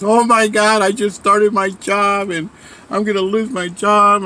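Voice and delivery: high-pitched mock freak-out. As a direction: imitate a friend panicking about the job, pitch jumping up. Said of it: "She's freaking out".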